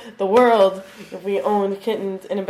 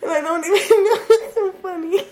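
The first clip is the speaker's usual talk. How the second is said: laughing till crying; 6 semitones higher than usual. Real, not acted. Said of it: "And I'm crying".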